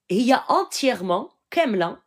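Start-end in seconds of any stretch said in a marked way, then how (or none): none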